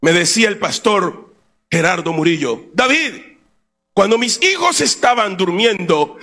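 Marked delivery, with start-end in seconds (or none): none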